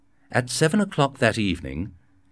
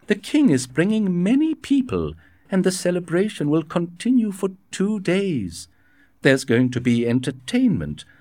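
raised, fretful host voice, words tumbling out with worry; a nobleman fretting over a costly royal party; worried Fouquet; 9 semitones higher, 3 dB louder than usual. A narrator's reading. Fouquet frets to Aramis about paying for the king's party, raised and anxious.

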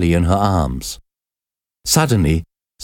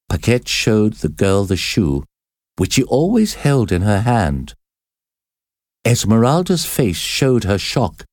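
plain reading voice

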